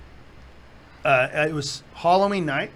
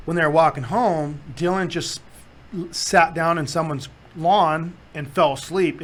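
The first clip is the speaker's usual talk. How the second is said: ordinary talk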